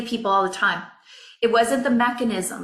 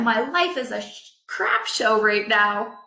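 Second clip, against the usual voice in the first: usual speaking voice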